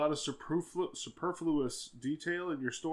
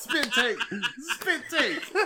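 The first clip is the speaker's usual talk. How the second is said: loud laughing yell, rising higher; excited shout; 11 semitones higher, 5 dB louder, slower than usual. Real, not acted. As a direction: shout it out while cracking up. Friend spitting out drink.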